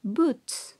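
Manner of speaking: "boots" said slowly with a long oo; pronounce boots. Shown as a demonstration. Teacher modelling "boots" for students, slow and careful. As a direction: model "boots" for the class to copy. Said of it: "You pronounce".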